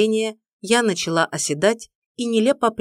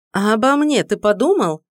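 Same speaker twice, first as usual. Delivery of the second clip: raised, louder indignant question; outraged heroine, higher and louder; 4 semitones higher, 6 dB louder than usual. A narrator's reading. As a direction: demand it, offended and loud. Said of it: "возмутилась я".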